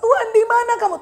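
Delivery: high-pitched cry to God; imitated urgent plea; Abraham under pressure. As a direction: call out in mock distress. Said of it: "ketika Abraham dalam tekanan".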